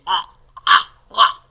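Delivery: ah with a tight constriction in the throat, like gagging; throat ayin sound. Shown as a demonstration. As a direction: make the ah from the back of the throat. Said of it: "the way this is really pronounced".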